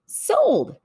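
high bright closing of a sale; triumphant sold. Acted. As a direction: snap the deal shut. Fox closing the deal.

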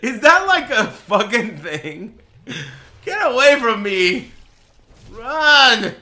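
very high, loud, stretched-out shrieks; panicked yelling. Real, not acted. Streamer fleeing a surprise threat in game, panicked yelling. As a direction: shout in alarm, as if fleeing.

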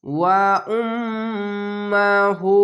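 "ওয়া উম্মাহু" with a long nasal hum on ম্ম; very slow chanted "ওয়া উম্মাহু", nasal hold on ম্ম; obligatory ghunna. Shown as a demonstration. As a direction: hum the doubled ম, then draw out হু. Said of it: "এখানে ওয়াজিব গুন্না".

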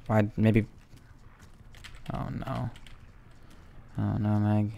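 ordinary talk

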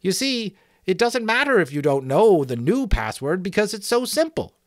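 high, piping explanation; soothing sentry. Acted. Sentry telling Jack about the new password, high placating voice. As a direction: explain helpfully in a high thin voice. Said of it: "the sentry answered soothingly".

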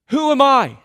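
high, loud plea of self-doubt; loud desperate Who am I; anguished cry. Acted. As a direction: cry it out like a man overwhelmed. Moses crying out to God.

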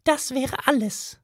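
high, firm little mouse; a mouse closing the matter. A narrator's reading.

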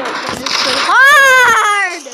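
drawn-out, rising, loud wail; frustrated wail. Real, not acted. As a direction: stretch the last word into a loud wail. Frustrated wail over game.

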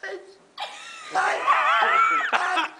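imitated high, straining shouts; failing shout. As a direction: mimic a rival whose shout gives out. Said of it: "He was shouting".

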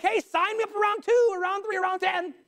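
high-pitched, hammed-up enthusiasm; mock eagerness. Mocking eagerness for hardship.

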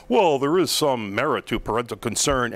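mock pompous official voice; bloviating superintendent. Host mocking a school superintendent's statement, bloviating. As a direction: put on a blustering bureaucrat, mockingly. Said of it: "bloviate about how".

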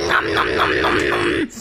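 deep growly nom noms; monster munching. Acted. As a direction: chomp like a monster. Monster eating sounds.

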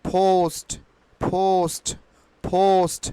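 "পোস্ট" as phoust, long gliding o; corrected pronunciation. Shown as a demonstration. Teacher drilling learners, "পোস্ট" with ou glide. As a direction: puff the p and stretch the o in "পোস্ট". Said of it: "ট এর উচ্চারণ কিন্তু".

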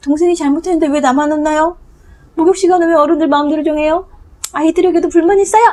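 put-on high, fast indignant child; child's voice. Reading the book's child, a high, fast complaining kid. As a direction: whine it like a wronged kid.

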